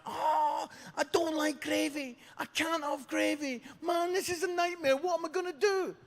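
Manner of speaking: very high, whiny wail; hammed-up gravy meltdown; mock panic. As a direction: squeal like a terrified student. Panicking student's quoted cry.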